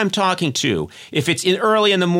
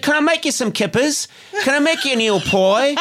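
high, eager Dickensian British servant; fawning British lackey, hammed up; [name]; 9 semitones higher than usual. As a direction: offer service in a mock Victorian British voice. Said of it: "this Dickensian nineteenth century British guy".

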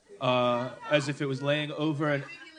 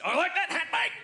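high-pitched mock Australian; attempted Australian accent; 13 semitones higher than usual. Shown as a demonstration. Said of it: "I can't do it fast".

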